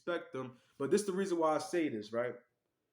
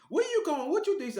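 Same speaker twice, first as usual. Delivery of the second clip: much higher, louder, confronting; high mock woman's voice; 12 semitones higher, 4 dB louder than usual. Retelling the girlfriend's confrontation, high and loud. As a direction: play her demanding where he's going.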